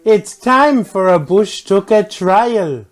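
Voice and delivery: high, loud, drawn-out announcement; hammed-up show opener. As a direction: belt out a show intro, stretching the words.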